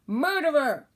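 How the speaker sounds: put-on baby shouting at his mother, loud. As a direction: scream the accusation. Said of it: "the baby shouted".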